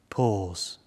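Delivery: usual speaking voice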